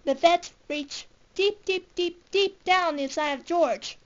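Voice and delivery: ordinary delivery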